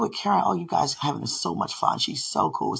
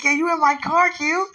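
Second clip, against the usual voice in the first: 1 excited; 12 semitones higher, 4 dB louder than usual. Retold or imitated. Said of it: "He was like".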